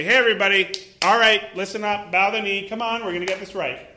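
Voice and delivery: loud, rallying order to the crowd; commanding God in power. Acted. Preacher voicing a powerful God commanding everyone, loud. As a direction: boom it out like a king calling his people to kneel. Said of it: "he didn't come in power and say".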